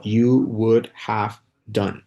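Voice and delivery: "you would have done" drawn out very slowly, each word clear; slow model pronunciation. Shown as a demonstration. Teacher slowly models "you would have done" for students. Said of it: "Es como".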